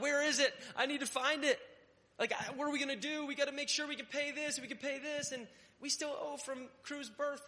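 high, frantic mock panic; panicked. Husband retelling his money worry, mock panic. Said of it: "I'm like".

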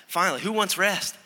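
ordinary talk

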